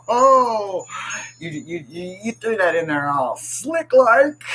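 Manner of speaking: high, laughing playful accusation; laughing tease. Real, not acted. Streamer laughingly teasing a sneaky chatter. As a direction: call them out while laughing.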